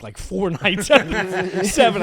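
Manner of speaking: slow, laughing through it; amused confession; laughing admission. Real, not acted. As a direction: confess it while cracking up.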